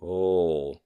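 ordinary talk, nothing marked

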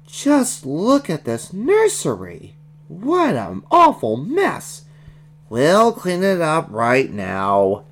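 high, slow scolding nanny voice; slow, disapproving high-pitched nanny; displeased Nanny. Acted. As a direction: scold the children in a drawn-out character voice.

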